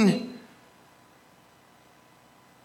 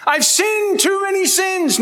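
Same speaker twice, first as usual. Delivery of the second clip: despairing sinner; 11 semitones higher than usual. Retold or imitated. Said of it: "when I've talked to certain people, like".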